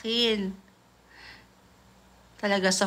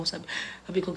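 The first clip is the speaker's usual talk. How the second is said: usual speaking voice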